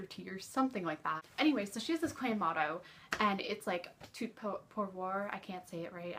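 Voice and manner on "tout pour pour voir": slow, halting French accent on "Tout pour, pour voir."; bad French accent. Shown as a demonstration. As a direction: stumble slowly through the French motto in a clumsy French accent. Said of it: "my French accent sucks".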